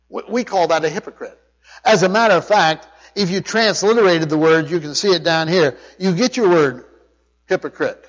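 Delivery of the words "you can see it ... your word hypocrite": ordinary delivery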